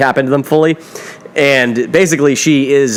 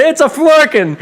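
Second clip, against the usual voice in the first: insistent; 12 semitones higher, slower than usual. Retold or imitated. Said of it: "He's like".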